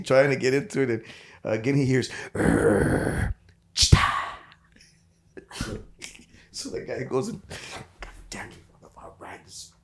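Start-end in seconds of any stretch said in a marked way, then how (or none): 2.3-4.0 low growl then a sudden loud yap; dog growl and yap. Retold or imitated. 8.0-9.7 soft unvoiced grumble to himself; whispered curse. Man muttering before searching.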